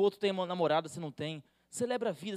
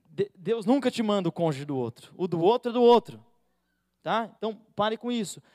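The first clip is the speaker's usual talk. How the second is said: ordinary talk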